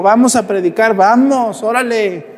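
high, forceful urging to get moving; rousing rally. Acted. Battered Saint Paul urges companions onward to preach, rousing call.

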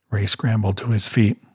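ordinary delivery

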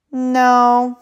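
put-on high, drawn-out child's refusal; imitated little boy. Kid's answer acted out.

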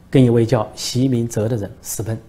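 usual speaking voice

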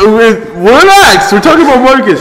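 high, loud, laughing words; gasping through laughter. Real, not acted. Still laughing at the story.

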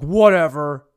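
loud put-on dismissive voice; changed voice. Guy brushes off his date's account, loud mimicked scoff. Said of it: "See how I changed his voice already?".

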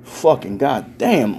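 ordinary talk, nothing marked

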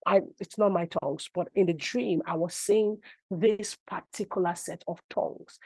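usual speaking voice

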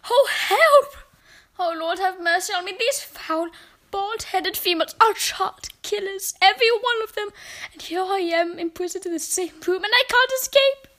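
high-pitched, terrified pleading; frightened, trapped outburst; panicked. A narrator's reading. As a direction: cry out for help in rising panic. Narrator voices the boy's terrified thoughts.

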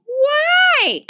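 put-on loud, very high child's question; childish questioning. A narrator's reading. Ramona questions her sister's rule, childishly.

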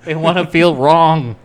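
loud, high-pitched mock outrage; comic rant. Co-host rants about people loving lies, loud mock outrage. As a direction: raise the voice into a hammed-up rant.

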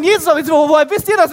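high, loud excited demand; shouted question. Acted. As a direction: disciples shout at the others asking how they know, in loud excitement. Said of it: "Und sie schreien".

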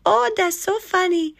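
put-on very high little-kid squeal and giggle; high-pitched giggly kid voice; mischievous kid. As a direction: play a gleeful child scribbling away. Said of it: "some kid ... comes along with a Sharpie and goes".

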